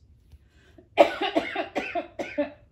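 loud dry cough; a sudden cough breaks the reading; real cough. Real, not acted. The reader coughing mid-story, a loud dry cough.

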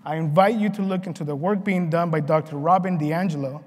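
ordinary talk

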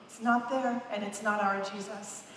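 ordinary delivery

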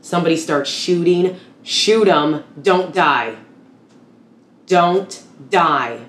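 loud, high, urgent shouting; shouted combat orders. Acted. Soldier reliving combat rules under gunfire, shouting.

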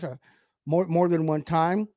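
ordinary talk

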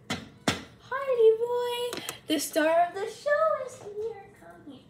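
high, slow cooing voice; pet talk. Real, not acted. Greeting her pet boy Hardy in high baby talk.

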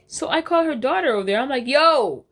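ordinary talk

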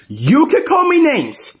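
high, deliberate acted outrage; indignant warning. Cuphead warning the one who hit him, indignant warning.

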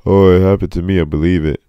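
high, loud mocking voice of a dickhead; mocking whiny victim. Ranting about people online, mock whiny voice. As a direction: sneer it out like the people you mock. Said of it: "motherfuckers who are just like".

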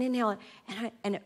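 normal talking voice

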